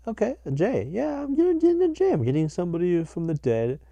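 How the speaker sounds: high, loud psychic channeling act; hammed-up high-pitched psychic reading; mock palm reader. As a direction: strain upward as if receiving a message. Imitating a palm reader's patter.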